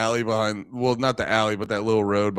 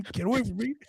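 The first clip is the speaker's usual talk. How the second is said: higher, softer get away; startled raccoon; 9 semitones higher, 7 dB quieter, faster than usual. Retold or imitated. Raccoon backing away from man, higher and softer. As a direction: shrink back and squeak it out.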